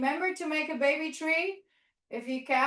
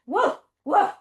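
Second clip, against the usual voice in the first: loud bouncy woof; playful dog bark; 4 semitones higher, 6 dB louder than usual. Pretend. Teacher barking with the kids as a dog pack, loud and playful. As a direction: bark like a happy puppy.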